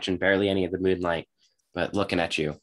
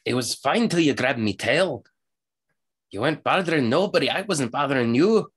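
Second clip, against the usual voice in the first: higher rustic character voice; grumbling halfling; 6 semitones higher than usual. Acted. Halfling complaining about his grabbed tail, aggrieved. Game master plays the halfling.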